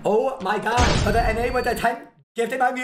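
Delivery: loud, high, hyped donation shout; announcer-style gift callout; hyped alert read. Acted. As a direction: blast it like a stream alert. Reading a donation alert aloud.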